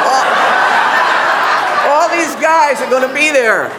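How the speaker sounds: loud, high, pointing him out; loud, eager urging; excited urging. Retold or imitated. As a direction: sell her on the guy, loud and eager. Retold sister-in-law's pitch.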